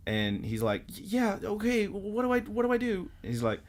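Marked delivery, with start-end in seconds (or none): 0.9-3.1 very high, stammering mock panic; panicked boy. Boy asking the bunny for help, high panicked voice. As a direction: play a flustered kid, voice pitched way up.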